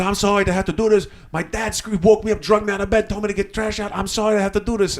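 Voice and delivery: high, fast flustered apology; acting out a harried kid pleading; imitated apologetic kid. Kid's frantic apology retold.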